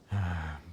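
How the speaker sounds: quiet, deflated exhale; soft defeated sigh. Acted. Merchant sighs over the stolen silk, soft defeated sigh. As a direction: let the breath fall out in defeat.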